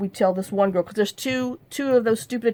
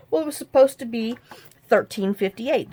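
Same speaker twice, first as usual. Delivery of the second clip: normal talking voice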